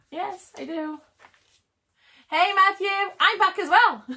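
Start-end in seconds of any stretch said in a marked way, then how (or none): 2.3-4.0 higher, louder hello; happy to see a viewer again; bright greeting. Real, not acted. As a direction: call out to a friend. Greeting viewer after reconnecting.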